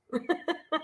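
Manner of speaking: high breathy laugh; short amused laugh. Real, not acted. Laughing at her dog.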